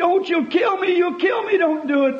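high, shouted cry of pain; agonized plea. Retold or imitated. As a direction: scream it like a boy pinned under a car. Pinned boy begging rescuers.

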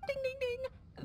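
playful quiz chime. Acted. Mother teasing her listeners like a game-show host.

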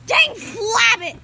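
loud, drawn-out frustrated yell; frustrated shout. Real, not acted. Kid missing the ball, shouting.

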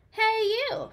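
high, loud little bug call; cranky ladybug calling out; grouchy ladybug. Acted. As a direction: bark it like a cranky tiny bug. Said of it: "said the grouchy ladybug".

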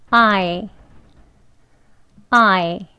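I said slowly, carefully; word drill. Shown as a demonstration. Teacher models the word I twice, careful drill. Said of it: "두 번 같이 해보겠습니다".

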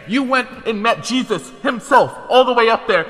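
high, loud protesting rant; outraged disbelief. Real, not acted. Gamer to an opponent who survived many hits, outraged yelling. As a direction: build to an exasperated shout.

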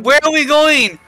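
high, loud, rushed; panicky high question. Real, not acted. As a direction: ask in a fluster. Panicking over controls mid-game.

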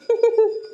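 sharp high scornful laugh; a quick sneering laugh; jeering laugh behind her back; mocking laugh. Sister-in-law mocks Shruti's dreams.

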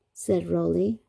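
usual speaking voice

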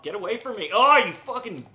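high, loud gamer cursing; heated game outburst; exasperated yell. Real, not acted. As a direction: fend off a pest in the game. Fighting over dragon in game.

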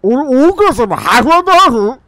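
loud, high-pitched disbelief; astonished question. Pretend. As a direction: shout the question in surprise. 驚いて大声で尋ねる. (驚いて大声で聞く)

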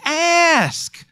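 high, insistent ASK; intense. Shown as a demonstration. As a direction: ASK leaping high and held long. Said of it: "some intensity".

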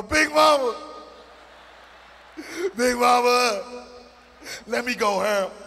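high, loud hammed-up sobbing wail of Big Mama; mock sobbing. Thug crying over Big Mama song, hammed-up sob. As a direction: wail for grandma through fake tears.

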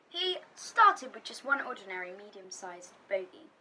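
ordinary delivery

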